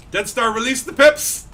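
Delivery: loud, high commander's order; mock battle command. Gamer ordering teammate Dead Star, mock battle command. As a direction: call out the order loudly.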